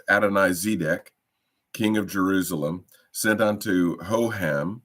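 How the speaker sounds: ordinary talk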